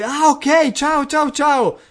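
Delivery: più acuta e forte, da anziana; signora al telefono. Retold or imitated. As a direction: saluta in fretta come una vecchietta. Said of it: "e lei". (signora anziana che saluta al telefono)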